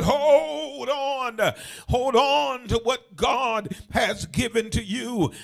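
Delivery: loud, stretched preacher's exhortation; full-force preaching. Real, not acted. Preacher urging church to hold on to God's gift, full force. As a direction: cry it out to the congregation.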